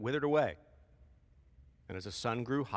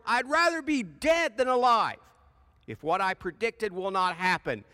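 sulky complaint; 7 semitones higher, 7 dB louder than usual. Acted. Jonah finishes complaint.

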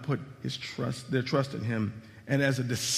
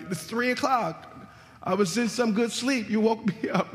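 high, mock-annoyed sleepy complaint with a laugh; groggy parent woken; 7 semitones higher than usual. Parent complaining to a kid at three in the morning, mock grumpy. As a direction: whine like a parent dragged out of bed. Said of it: "and you're like".

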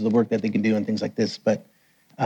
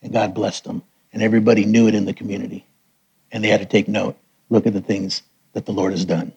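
ordinary talk, nothing marked

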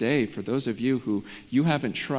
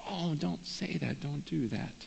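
soft, higher, slow pleading; hushed plea; 3 semitones higher, 8 dB quieter than usual. Acted. Preacher begging an unbeliever not to refuse, hushed plea. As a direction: plead gently, dropping the volume right down.

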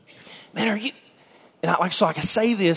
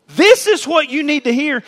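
loud, higher, punching the word this; forceful proclaiming; 9 semitones higher, 6 dB louder than usual. Acted. John telling the crowd what they need to hear, loud and pressing. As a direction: press it on them like a prophet.

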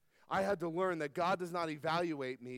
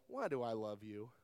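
tender bedtime question; 8 semitones lower, 5 dB quieter than usual. Retold or imitated. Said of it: "and I go".

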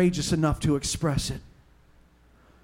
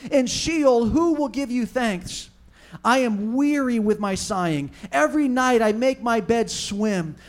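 raised, pleading scripture reading; fervent psalm reading; 4 semitones higher than usual. Acted. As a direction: read it out as an earnest plea to God. Reads the psalm aloud as prayer.

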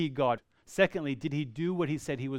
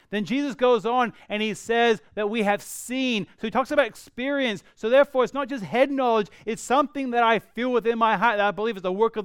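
rising, louder, quicker preaching; fervent preaching; 6 semitones higher, 6 dB louder than usual. Real, not acted. Pastor urging his congregation, louder and faster. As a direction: press the point home with building fervor. Preaching builds to full force.

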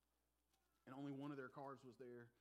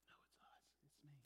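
quiet confession; 16 dB quieter than usual. Real, not acted.

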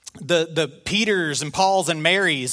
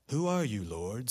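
much lower, softer, stiff and solemn; stuffy formal reading; 6 semitones lower, 10 dB quieter than usual. Retold or imitated. Preacher mocking how Saul is usually read. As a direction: read it like a dry formal recital.

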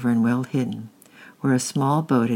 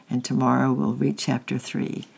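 ordinary delivery, nothing marked

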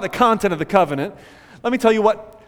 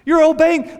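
higher, pleased voice of God, warmly approving; 8 semitones higher, 4 dB louder than usual. Pretend. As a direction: play God beaming at his people. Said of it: "And God's like".

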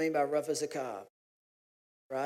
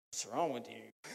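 low, quiet mock male voice; the guys' reaction; 8 semitones lower, 11 dB quieter than usual. Guys reacting to his pottery hobby, low and quiet. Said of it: "some of the guys are like".

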